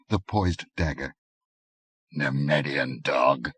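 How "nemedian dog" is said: slow, low assassin's mutter; low muttered threat. A narrator's reading. Assassin to his victim, a slow low mutter. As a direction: growl it close to his ear. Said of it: "muttered a voice thick with passion".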